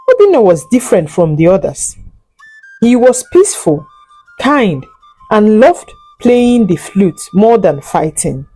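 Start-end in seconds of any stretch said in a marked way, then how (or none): none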